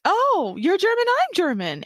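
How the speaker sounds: high-pitched imitation of eager Americans; mock enthusiastic American. Mimics Americans claiming to be German.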